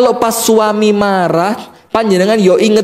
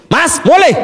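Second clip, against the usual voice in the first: bossy wife; 10 semitones higher than usual. Acted. Wife phoning her husband with a high bossy command. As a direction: snap the order down the phone.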